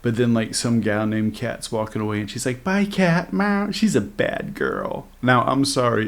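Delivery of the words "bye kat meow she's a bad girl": mock flirty voice. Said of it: "the way that she said that sounded like a bisexual swinger".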